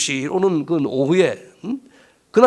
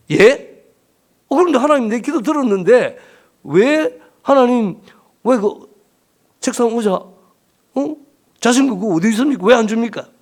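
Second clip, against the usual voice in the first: raised-pitch, incredulous retort, acted out; startled protest; 4 semitones higher, 4 dB louder than usual. Praying pastor questioning God about unanswered prayer, acted indignant surprise. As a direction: burst in puzzled, demanding an explanation.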